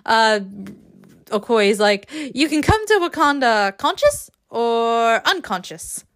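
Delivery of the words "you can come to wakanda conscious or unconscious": deliberate, higher-pitched fake Wakandan accent; amateur Wakandan accent impression; Okoye accent attempt. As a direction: have another go at a Wakandan accent. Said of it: "that's a terrible accent".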